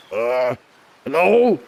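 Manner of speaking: gruff, raspy character voice try; first try at a raspy voice; raspy muscular voice. Shown as a demonstration. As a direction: push a rasp into it. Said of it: "sort of muscular kind of voice, but it's kinda raspy".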